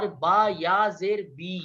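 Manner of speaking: slow, drawn bee; stretched long ee; long vowel bee. Shown as a demonstration. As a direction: hold the ee in بی long. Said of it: "یا مدہ بھی ہے".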